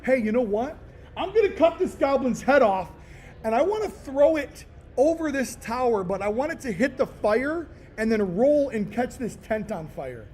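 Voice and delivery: high, loud, hyped-up battle plan; excited gamer shout. Retold or imitated. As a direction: shout out the wild plan, thrilled. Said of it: "You're just".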